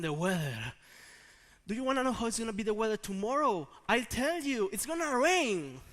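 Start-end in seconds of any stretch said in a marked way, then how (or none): 1.7-4.7 high, loud, rapid hype; hyped forecaster. Acted. Geographer proudly offering tomorrow's weather, hyped-up. 4.7-5.8 high, loud, drawn-out RAIN; triumphant forecast. Acted. Geographer announcing tomorrow's weather, triumphant. Climax of mock forecast, later said plainly.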